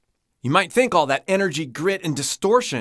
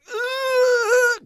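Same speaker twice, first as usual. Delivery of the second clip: straining grunt. Shown as a demonstration. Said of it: "The same muscles and the approach that you would use to lift something really heavy".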